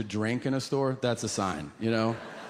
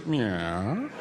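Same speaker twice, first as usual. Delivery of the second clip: sheepish yes; 3 semitones lower than usual. Retold or imitated. Senator's odd answer.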